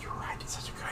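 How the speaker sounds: hushed, voiceless realisation; shopkeeper's stunned stage whisper; awed whisper. Acted. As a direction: whisper it as if amazed by the idea. Shopkeeper struck by customer's soap idea.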